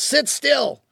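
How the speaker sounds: high, sharp mock order; a hint of an impatient parent's command; snapping parent. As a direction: bark it like an exasperated parent. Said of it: "one of my favorites".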